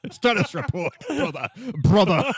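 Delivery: space marine call. Acted. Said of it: "space marine quote".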